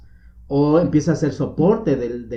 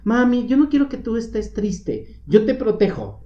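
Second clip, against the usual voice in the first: put-on higher, childlike pleading to mommy; child's voice; 8 semitones higher than usual. Host imitating a child comforting his sad mother, higher childlike voice. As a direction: sound like a little boy comforting his mom. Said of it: "donde de repente es".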